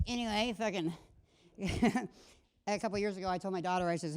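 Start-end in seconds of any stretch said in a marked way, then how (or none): none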